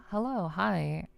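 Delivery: normal talking voice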